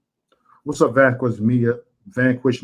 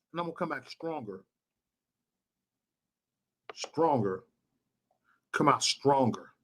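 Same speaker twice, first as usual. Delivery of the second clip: very soft, intense vow; hushed resolve; 11 dB quieter than usual. Real, not acted. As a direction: drop right down and promise it quietly. Same vow, suddenly hushed after shouting.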